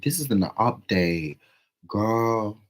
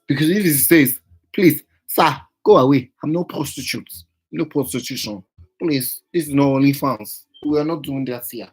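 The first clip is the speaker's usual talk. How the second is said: louder, higher hammed-up outrage; mock indignant rant; 7 semitones higher, 10 dB louder than usual. Telling off the stranger as if he were there, mock outrage. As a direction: tell him off like an offended auntie.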